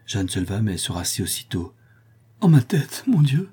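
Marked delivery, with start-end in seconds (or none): 2.4-3.5 high, loud cry of head pain; sudden headache, voice jumping up in pain; pained hangover groan. Acted. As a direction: clutch your aching head and moan it out. Hungover Jeanne trying to stand.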